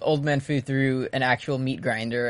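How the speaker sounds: ordinary delivery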